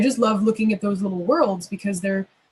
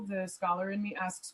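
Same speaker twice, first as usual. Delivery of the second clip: usual speaking voice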